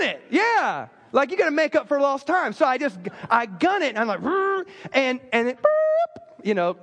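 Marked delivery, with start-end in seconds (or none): 4.2-4.6 high revving car noise; imitated engine rev. Driver guns it past the slow car, imitated engine rev. 5.6-6.5 high siren blip; imitated police siren. Police car pulls the speeder over, imitated siren.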